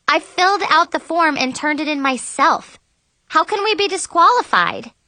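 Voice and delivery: high, upset pleading; near tears. A narrator's reading. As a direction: protest as if about to cry. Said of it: "I was on the verge of tears".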